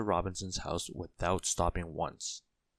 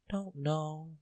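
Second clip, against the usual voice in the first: high, soft little voice; small voice; 9 semitones higher, 4 dB quieter than usual. A narrator's reading. Mister Small answering Mister Robinson in a small, high, soft voice. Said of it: "in a small voice".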